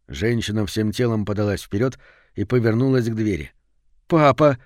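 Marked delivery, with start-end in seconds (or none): 4.1-4.6 put-on higher, louder happy boy's cry; cheerful, sudden exclamation; joyful exclamation. A narrator's reading. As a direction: call out to Dad with glee. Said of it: "весело воскликнул мальчик".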